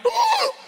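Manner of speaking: sharp high gasp of horror; shocked gasp. Retold or imitated. Realizing he told Tim he loves him, a sharp high gasp. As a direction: gasp as the slip sinks in. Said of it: "freaking out".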